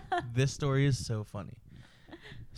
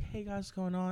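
a hint of higher, chirpy service greeting; lightly put-on friendly cashier voice; customer service voice; 8 semitones higher, 6 dB quieter than usual. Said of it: "my customer service was like".

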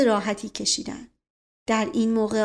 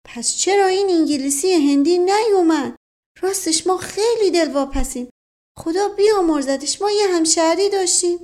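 higher, louder village servant chatter; higher-pitched folksy servant voice; rustic servant voice; 8 semitones higher, 5 dB louder than usual. Acted. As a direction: play the garrulous old servant. Mash Qasem's voice, rustic servant.

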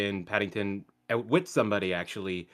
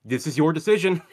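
higher, louder pointed line; mock clerk; 6 semitones higher, 8 dB louder than usual. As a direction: lay the blame on them, mock official.

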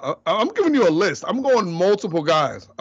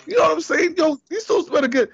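excited; 11 semitones higher than usual. Real, not acted. Hyped about the commercial.

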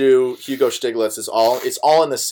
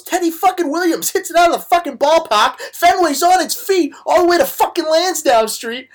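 high, loud Boston accent impression; 4 dB louder than usual. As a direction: holler it like a hammed-up Boston ballgame caller. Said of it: "What's the accent, too?".

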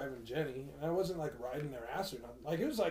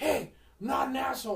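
higher, louder indignant mock shout; retold yell; 9 semitones higher, 5 dB louder than usual. Driver answering the insult, retold indignant yell. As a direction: call it out like an offended driver. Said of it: "he yells at the guy".

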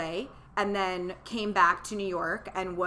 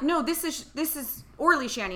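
confused correction; 7 semitones higher, 3 dB louder than usual. Retold or imitated. Confused by nurse sharing her name.

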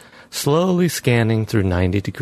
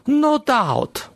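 much higher, questioning woman voice; police chief voice. Acted. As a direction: skeptical chief, raised pitch. Chief replies dryly.

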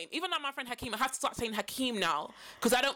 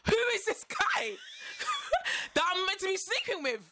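laughing disbelief; 7 semitones higher than usual. Real, not acted. Incredulous laughing retelling.

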